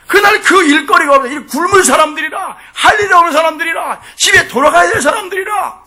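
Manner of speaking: high, shouted preacher's cadence; full-force preaching. Real, not acted. Preacher proclaiming the owner's generosity to hungry workers, full force.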